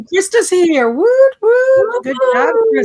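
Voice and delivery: loud, high whooping welcome; excited cheering. Real, not acted. Host hails a viewer joining the livestream, excited cheering. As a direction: cheer them in, voice way up.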